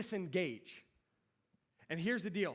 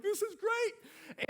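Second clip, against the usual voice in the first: drawn-out, soaring delight; 5 dB louder, much slower than usual. Retold or imitated. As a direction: stretch it out slow, way up high. Delighted at self-driving car.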